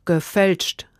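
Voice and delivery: ordinary delivery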